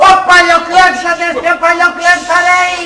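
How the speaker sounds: put-on slow, loud drunken shout about thieves. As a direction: bellow it out, slow and tipsy. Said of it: "Μη φωνάζεις, μη φωνάζεις".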